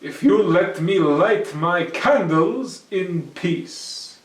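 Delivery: loud, slow and firm; Herschel, firm. Acted. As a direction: state the terms. Herschel's condition.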